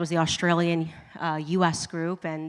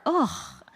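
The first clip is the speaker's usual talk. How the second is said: breathless whispered ugh of disgust; grossed-out ugh under the breath; 6 semitones higher than usual. Real, not acted. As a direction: recoil with a voiceless ugh. Disgust at pork butter.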